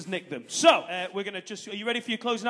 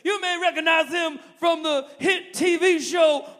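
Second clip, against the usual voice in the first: loud, slow, higher announcer build-up; hype compère; 7 semitones higher, 8 dB louder, slower than usual. Retold or imitated. Compère introducing Bernie Mac to the arena, loud slow hype. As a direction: hype the crowd like a big American compère. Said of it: "he goes".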